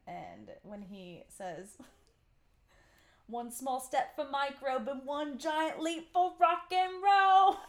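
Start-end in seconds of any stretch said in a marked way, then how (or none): 3.3-7.6 brash space-age rock star, building to a shout; Protozoa impression. Fan imitating Protozoa's goofy accent, rising to a shout. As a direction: pop star voice pitched up, louder toward "roll". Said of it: "Protozoa's very authentic accent".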